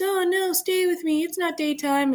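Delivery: mock high-pitched plea; pleading Juliet. Teacher paraphrasing Juliet begging Romeo, mock pleading. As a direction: summarize her plea in a high, imitating voice. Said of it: "So she's".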